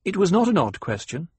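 a touch of brittle firmness in the comeback; brittle riposte. Acted. Paul retorts to the banker, mildly brittle. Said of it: "the brittle riposte quality of her training".

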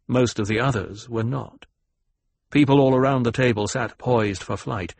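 plain reading voice

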